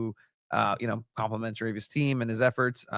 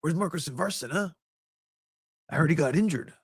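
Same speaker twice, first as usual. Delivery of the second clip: Danny Trejo impression; 5 semitones higher than usual. Actor quizzes coach about a missing player, put-on impression. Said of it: "There's Danny Trejo interviewing fake Mikey Varas".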